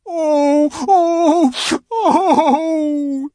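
loud, high theatrical wailing; sobbing. A narrator's reading. Faun breaking down in front of Lucy, sobbing. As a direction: wail out each sob. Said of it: "sobbed Mister Tumnus".

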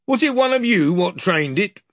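mock the prisoners as a crude trader; jeering slaver. Slaver asking the prisoners, loudly jeering.

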